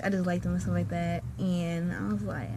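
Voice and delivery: ordinary delivery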